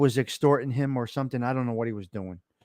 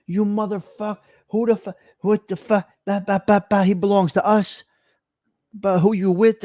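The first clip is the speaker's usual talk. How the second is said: louder and higher, a furious demand; angry confrontation; 5 semitones higher, 5 dB louder than usual. Retold or imitated. Skins in his face at Pathmark demanding who he's with, shouting angrily.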